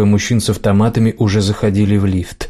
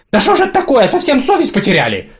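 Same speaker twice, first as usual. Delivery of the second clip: put-on shrill, much higher crazy old crone; shrieking old woman. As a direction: screech like a batty old lady. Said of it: "визгливо закричала она".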